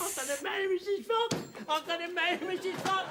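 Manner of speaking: very high, giddy announcement; overjoyed. Acted. Accepted suitor announces his marriage, ecstatic. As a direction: burst out with joy at top pitch.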